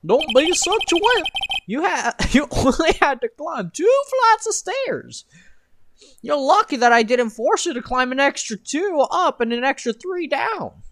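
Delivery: mock him like a giggling clown; silly clown. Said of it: "your clown voice".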